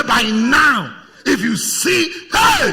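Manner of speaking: loud, rising daydreaming shout; excited boast. Retold or imitated. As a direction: boast to yourself about what you'd get. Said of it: "You say".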